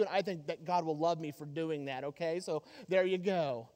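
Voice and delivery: a smug show-off bragging at low volume; lightly voiced bragging husband; boastful giver. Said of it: "another bad reason to give is boastfully".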